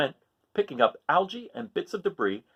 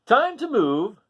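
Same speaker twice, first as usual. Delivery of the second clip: higher, louder little crab voice; Hermit Crab voice; 9 semitones higher, 7 dB louder, slower than usual. Acted. Narrator voicing Hermit Crab to listening children, higher and louder. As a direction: chirp it out like an eager small crab.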